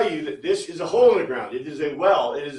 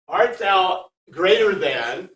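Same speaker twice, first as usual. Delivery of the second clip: "art thou greater than" drawn out slowly, rising on "greater"; slow archaic question; 7 dB louder, much slower than usual. Shown as a demonstration. Teacher quoting the old translation slowly, rising on "greater". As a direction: read the King James words slowly, as a question. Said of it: "change it from".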